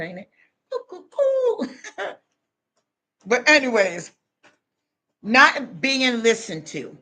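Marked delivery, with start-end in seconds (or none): 0.7-2.1 high, silly cuckoo hoot; cuckoo call. Retold or imitated. Mocking the cuckoo bin, high cuckoo hoot. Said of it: "That's how they sound".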